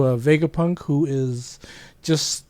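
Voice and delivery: usual speaking voice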